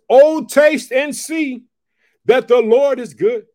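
loud, slow, high preaching burst; fired-up preacher reading scripture; going off. Real, not acted. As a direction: explode into the verse. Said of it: "You going off like that, [name]?".

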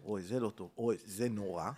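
low, soft dismayed mumble; stoned guest thinking to himself, soft and low; worried inner thought. Retold or imitated. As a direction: mutter it to yourself as you realise it's bad. Narrator's scared inner thought.

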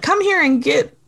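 high-pitched fake polite phone voice; put-on telephone politeness, lifted high; phony phone voice. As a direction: imitate someone being overly polite on the phone. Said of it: "using her phony phone voice".

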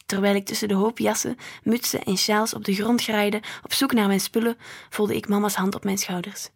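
ordinary delivery, nothing marked

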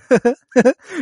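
high, loud giggling laugh; high mock cackle of delight; imitated laugh. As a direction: mimic a kid's excited laugh. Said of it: "he's, like, laughing".

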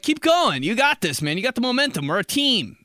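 high, loud encouragement; mock cheerleading. Cheering partner through second level, high loud encouragement. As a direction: cheer your teammate on loudly. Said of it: "And I'm like".

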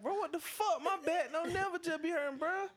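high exasperated outburst; exasperated cry. Retold or imitated. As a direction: yelp it like the pain is too much. Frustrated by back pain.

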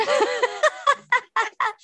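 high delighted laugh. Real, not acted. Host laughing at guest's joke, bright laugh. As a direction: burst out laughing.

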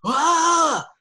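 a high, loud yell into the forest; high loud imitated yell. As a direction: belt out a wild cry as if into the trees. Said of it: "yelling into the forest".

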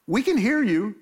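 mock-outraged brother; indignant outburst. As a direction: throw it back at the father, wounded. Brothers indignant at father's favoritism.

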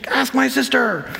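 put-on whiny kid. Kid dodging chores, voiced.

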